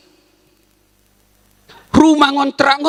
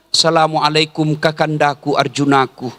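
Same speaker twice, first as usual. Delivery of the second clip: a hint of a loving wife greeting her husband; sweet wife greeting; 10 semitones lower than usual. (sapaan istri yang manis)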